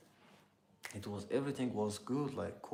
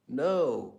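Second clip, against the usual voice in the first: loud, high exclaimed no; emphatic denial; 6 semitones higher, 13 dB louder than usual. Real, not acted. Streamer rejects guess about living near Ukraine, loud emphatic denial. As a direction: burst out with a sharp rise in pitch and volume.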